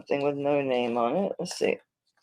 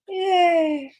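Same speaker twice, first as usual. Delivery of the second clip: little cheer of relief. Real, not acted. Relief at finding unsold ring.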